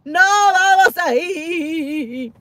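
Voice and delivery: slow, stretched no vamos ahí; "vamos" stretched long and slow; drawn-out teasing refusal. Real, not acted. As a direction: drag out "No vamos ahí" playfully.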